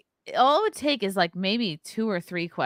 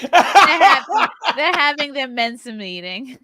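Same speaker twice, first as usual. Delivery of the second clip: much higher, louder, stretched sing-song teasing mom voice; 5 semitones higher, 8 dB louder, slower than usual. Retold or imitated. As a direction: draw out having like a mocking mom. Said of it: "my mom would call it".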